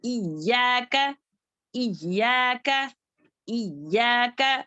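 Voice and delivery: इय्याका leaning hard on the doubled Y; pressed doubled ya. Shown as a demonstration. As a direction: press and hold the य्य in इय्याका. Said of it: "या को अच्छे से press करें".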